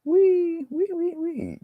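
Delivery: high squealing wee wee wee; commercial pig squeal. Retold or imitated. Painter reminding viewers of the commercial pig, high squeal. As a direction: squeal like a pig with its head out the window. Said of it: "the pig with his head out the window".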